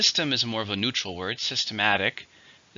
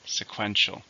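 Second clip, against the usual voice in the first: sequential said with the weight on "-quen-"; teacher models sequential, stress on "-quen-"; stress on the second syllable; slower than usual. Shown as a demonstration. Model the word for students, stress on "-quen-". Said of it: "stresses on the second".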